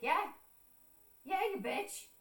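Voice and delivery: high, pointed mock comeback; retold indignant agreement; indignant retort. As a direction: throw it back, pitch jumping up. Retold heated retort to aunt.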